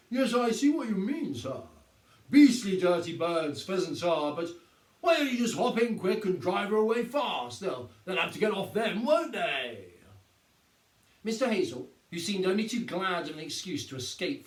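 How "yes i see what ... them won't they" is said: mock-helpful sergeant. A narrator's reading. Sergeant feigning helpful advice.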